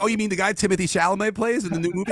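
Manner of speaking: high, loud, fast mock voice of a clueless kid; mocking a young fan who only knows the movie; clueless youngster. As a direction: sneer it out like an oblivious young fan. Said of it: "They're gonna be like".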